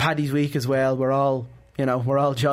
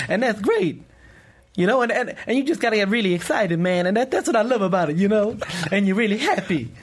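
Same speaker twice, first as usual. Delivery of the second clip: excited, higher Eddie Murphy patter; 3 semitones higher than usual. Acted. Impressionist hypes the radio hosts as a higher, excited Eddie Murphy. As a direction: get really excited, man. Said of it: "your Eddie Murphy impression".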